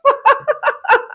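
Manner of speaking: high-pitched burst of laughter; amused laugh. Real, not acted. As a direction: crack up at what was just heard. Reaction to the clip's rant.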